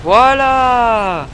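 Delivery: loud, long triumphant cry; triumphant shout. Real, not acted. Gamer winning the round, triumphant shout. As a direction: crow over the win.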